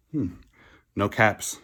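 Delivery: ordinary delivery, nothing marked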